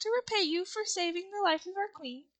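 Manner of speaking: high, small, earnest mouse. A narrator's reading. Big mouse offers to repay the Woodman, high mouse voice. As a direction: ask politely in a high mouse voice. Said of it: "one of the biggest mice spoke".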